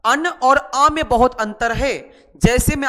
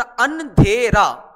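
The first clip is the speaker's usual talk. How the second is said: slow sounding out; much slower than usual. Shown as a demonstration. Teacher reading the finished word to pupils, slow. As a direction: stretch every sound of the word slowly. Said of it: "एक ही मात्रा".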